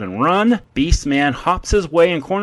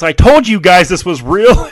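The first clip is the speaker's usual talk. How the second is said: retold yell; 3 semitones higher, 7 dB louder than usual. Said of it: "Adam yells".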